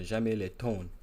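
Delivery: slow, careful attempt at French; best French accent. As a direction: put on French, syllable by syllable. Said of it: "let me pull up my best French accent".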